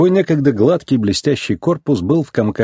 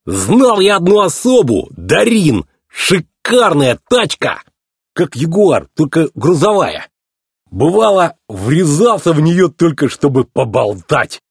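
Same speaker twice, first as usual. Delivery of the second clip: higher, slow, sing-song yokel voice; boastful tow truck; 5 semitones higher, slower than usual. Acted. Mater tells about an old flame, proud tow truck. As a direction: reminisce proudly, stretching the words.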